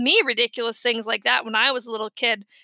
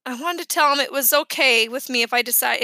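plain reading voice

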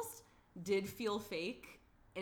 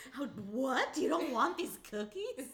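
high, loud incredulous disbelief; 7 semitones higher, 7 dB louder than usual. Retold or imitated. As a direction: act shocked someone refuses cookies. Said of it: "Like, incredulous".

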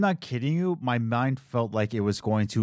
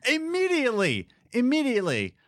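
emphatic; 12 semitones higher than usual. Real, not acted. Emphatic high retelling.

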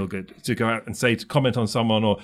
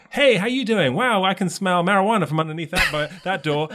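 higher, bright chirpy greeting, lightly voiced; imitating cheery daughter; 6 semitones higher than usual. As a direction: play the bubbly girl greeting everyone.

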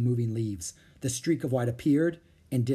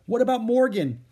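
high, louder plea; insistent protest; 10 semitones higher, 6 dB louder than usual. Acted. Annie pressing Jack about Morgan, protesting. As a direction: press the objection, pitched up.

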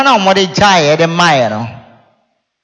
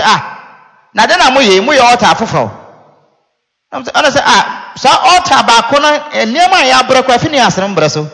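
raised, louder quoting voice; lightly voiced quote; 7 semitones higher, 4 dB louder than usual. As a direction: voice them higher and louder. Quoting people about new altar.

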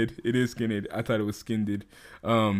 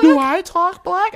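squeaky, much higher cartoon question; Mickey Mouse voice; 5 dB louder than usual. Shown as a demonstration. Trying out a Mickey Mouse voice for a friend. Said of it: "I sound like Mickey".

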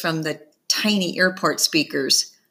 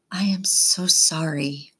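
whisper. A narrator's reading. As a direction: breathe it out, barely voiced. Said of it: "she whispers".

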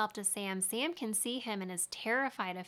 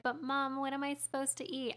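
put-on a light, higher little-boy whine; hungry little boy; 5 semitones higher than usual. Small son pleading with his mother for food, higher little-boy voice. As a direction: plead like a hungry kid asking his mom. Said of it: "I can't do an Australian accent".

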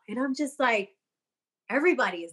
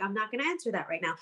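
soft and gentle; 3 semitones lower, 6 dB quieter, much faster than usual. Retold or imitated. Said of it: "answer them really soft and gentle".

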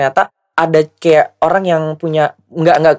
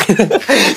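giggles at the memory; 3 semitones higher than usual. Real, not acted. Laughs after quote.

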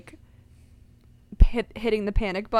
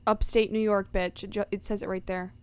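ordinary delivery

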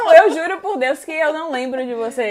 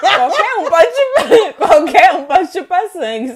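loud, high words bursting through laughter; cracking up; 5 semitones higher, 10 dB louder, slower than usual. Real, not acted. Player disputes a silly superpower answer, cracking up loudly. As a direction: try to argue while helpless with laughter.

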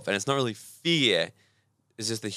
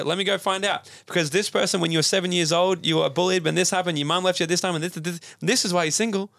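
higher, helpful know-it-all; the brain's search system answers, pitched up and eager; 6 semitones higher than usual. Retold or imitated. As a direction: play the brain rattling off reasons. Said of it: "The Raz will go".

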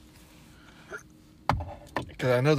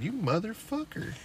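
mock indignation; 11 semitones higher than usual. Cursing at dog in story.

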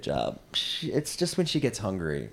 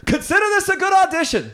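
high, shouted parting shot; imitated yell, pitched way up; Larry David; 10 dB louder than usual. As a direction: yell it down the hallway. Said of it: "looks out the hallway and he goes".

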